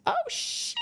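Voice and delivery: sudden shrieking very high oh shit; a real jolt of fright that jumps way up; startled yelp. Real, not acted. Reader caught off guard mid-reading, real startled shriek.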